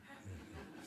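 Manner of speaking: a brief real laugh; quick quiet laugh to yourself; short laugh. Real, not acted. Reader laughs at an interruption.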